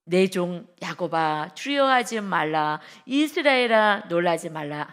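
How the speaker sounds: slow, loud proclamation of God's word; solemn booming scripture read. Acted. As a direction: read it out slowly like the voice of God. Pastor reads God's words aloud.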